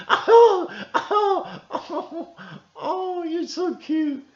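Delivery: very high, loud laughing gush, slowing at the end; hammed-up giggly fawning over a cute man; imitating Hillary's gush. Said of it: "doing the Hillary".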